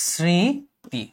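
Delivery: স্মৃতি as a slow "srĩti", the m silent; careful model pronunciation. Shown as a demonstration. Teacher modelling the correct word, careful pronunciation. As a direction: stretch the first syllable of স্মৃতি, then a short "ti". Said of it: "এই স্মৃতি শব্দটার উচ্চারণ হবে হচ্ছে".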